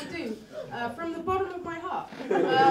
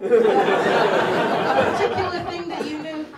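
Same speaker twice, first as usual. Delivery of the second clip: normal talking voice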